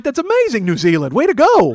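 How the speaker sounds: high, loud cheering praise; amazed congratulation. Retold or imitated. Comedian congratulating seatmate on victory, impressed cheer. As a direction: whoop it up for his triumph.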